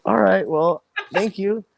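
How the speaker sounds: awkward thanks. Retold or imitated.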